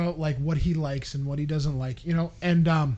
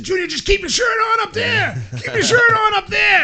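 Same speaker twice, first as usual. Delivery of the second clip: high, loud dad shout; calling out; 7 dB louder than usual. Retold or imitated. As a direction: holler up to a kid on stage. Retold shouting up to his son.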